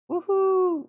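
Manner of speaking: high, loud "woohoo" cheer; excited whoop. Real, not acted. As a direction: whoop it out in delight. Cheering a viewer's message.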